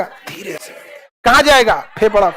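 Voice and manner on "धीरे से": near-silent धीरे से; very soft. Shown as a demonstration. Class answering the teacher in a hush. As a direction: barely breathe धीरे से. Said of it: "कैसे ढकेलेगा".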